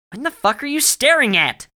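high, very loud demand; alarmed angry yell. A narrator's reading. Barry sees Alex staring at blank space, yelling in alarm. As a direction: yell it at a high pitch, rattled.

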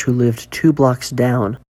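plain reading voice

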